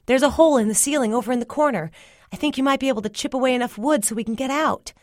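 high, quick, pressing explanation; urgent. A narrator's reading. Telling Cyril of a way out, urgent. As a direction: hurry out the escape plan.

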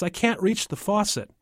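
put-on high child's complaint; little boy. Tommy can't reach the tub, in a little boy's voice. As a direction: pipe it up like a small kid.

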